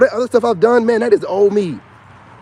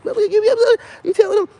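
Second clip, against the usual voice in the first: much higher, slow mocking squeak; mocking stepson; much slower than usual. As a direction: mimic the kid laughing at him. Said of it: "he laughing at it".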